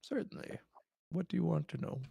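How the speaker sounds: put-on high frail old voice; gentle elderly king; very old man.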